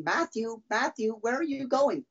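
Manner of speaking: high-pitched mock yell, no real volume; retold yell. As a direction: imitate a grandmother calling out after someone. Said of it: "She comes running out of the store yelling".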